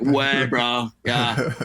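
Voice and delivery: put-on French said with a California trucker accent. Mocking his own French, in a California trucker accent. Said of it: "It sounds like a trucker from California".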